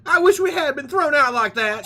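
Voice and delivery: put-on high, loud Southern belle accent. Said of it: "She's gonna be Southern now".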